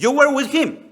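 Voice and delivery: high, accusing maid. Acted. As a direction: point the finger high.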